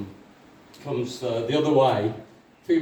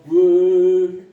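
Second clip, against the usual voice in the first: long, loud "woo"; imitated pint downing; 5 semitones higher, 9 dB louder than usual. Comedian miming the next pint, loud woo. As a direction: hold a loud, high woo.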